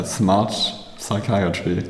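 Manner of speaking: ordinary talk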